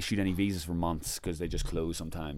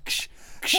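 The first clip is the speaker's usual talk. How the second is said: sharp imitated fizz of bottles opening; bottle-opening noise. Storyteller imitating the consul opening Guinness, fizzing noise. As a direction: make two hissing cap-pop sounds. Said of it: "he goes".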